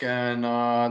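ordinary talk, nothing marked

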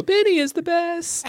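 put-on very high falsetto kids' cheer; squeaky singsong chant; children's chorus. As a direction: pipe it like a bunch of excited kids. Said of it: "then they're all gonna go".

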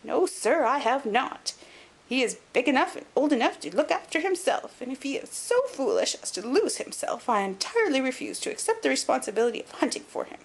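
put-on high, indignant old lord; querulous old man. A narrator's reading. Old lord refusing to hunt for his nephew, querulous and high. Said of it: "a querulous voice".

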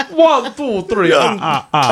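slow, high vampire greeting, laughing; Dracula voice. Shown as a demonstration. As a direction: count like a cartoon vampire. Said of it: "Say Merry Christmas".

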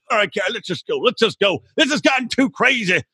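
ordinary talk

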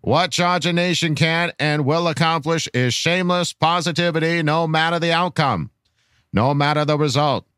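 put-on measured, old-fashioned presidential speech delivery; grand political orator. Reading a fan's rallying question to Charger Nation, grand oratory. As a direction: deliver a solemn, rousing address with dropped R's.

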